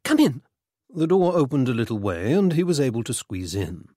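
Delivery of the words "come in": high, flustered hurrying; flustered urgency. A narrator's reading. Mother hurries her son indoors, flustered. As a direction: usher someone in, pitch climbing with urgency.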